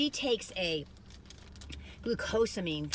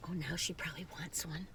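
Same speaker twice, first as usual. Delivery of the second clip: soft whisper about the dog; whispered aside; 2 semitones lower, 8 dB quieter than usual. Real, not acted. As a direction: breathe it as an aside.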